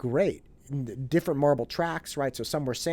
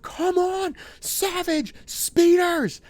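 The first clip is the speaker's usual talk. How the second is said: much higher, loud cheering yell at the screen; screaming; 8 dB louder, much slower than usual. Retold or imitated. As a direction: scream for your marble team. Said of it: "I'm screaming".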